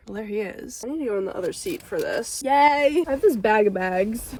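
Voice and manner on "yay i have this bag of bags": loud, high little yay; excited cheer. Real, not acted. Cleaning the car for chat, a loud excited cheer. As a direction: cheer it out with delight.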